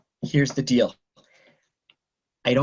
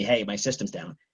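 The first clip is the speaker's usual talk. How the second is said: casual quote; 9 semitones lower, 6 dB quieter than usual. Retold or imitated. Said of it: "you should have told me".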